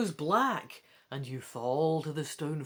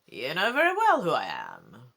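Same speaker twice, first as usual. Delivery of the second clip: lower, louder menacing growl; 5 semitones lower, 7 dB louder than usual. Acted. Ghost king growling at the trapped boy, menacing. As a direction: growl it like a villain. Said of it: "the voice growls".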